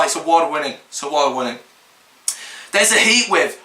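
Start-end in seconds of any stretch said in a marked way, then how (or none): 0.0-0.7 sarcastic. Real, not acted. Sarcastic praise of journalism. 0.9-1.6 sarcastic. Real, not acted. Sarcastic repeat, quieter.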